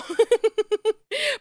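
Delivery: high bright laugh. Real, not acted. Streamer laughing at her own accent, high laugh. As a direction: let out a quick laugh.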